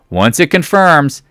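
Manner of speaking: "confirms" stretched out and pitched high; high, drawn-out "confirms"; emphatic rule. Shown as a demonstration. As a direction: drive home "confirms" slowly.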